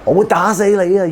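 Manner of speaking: high, loud exasperated "what the hell"; loud, high irritated exclamation; annoyed outburst. Retold or imitated. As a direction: snap it at a stranger in a crowded street. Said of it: "You're gonna be".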